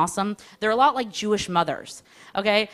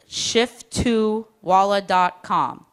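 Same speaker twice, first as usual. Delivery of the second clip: slowly spelled out; much slower than usual. Retold or imitated. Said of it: "spelling out the email slowly".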